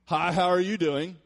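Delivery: loud, slow, overly bright hello; a bracing "here we go" turning into a loud fake-friendly hi; forced cheery greeting. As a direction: put on a brave, cheerful face and greet them loudly. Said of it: "And I was like".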